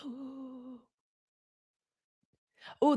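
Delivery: normal talking voice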